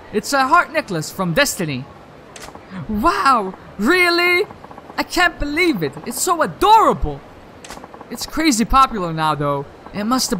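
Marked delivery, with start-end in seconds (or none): 2.9-7.2 a hint of a higher, delighted girlfriend; lightly voiced excited girlfriend. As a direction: gush over the gift. Voices the girlfriend receiving gift.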